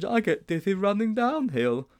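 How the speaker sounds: put-on high boyish character voice; high-pitched schoolboy worry; fretful Sponge. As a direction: complain anxiously up high. Sponge dreads the race.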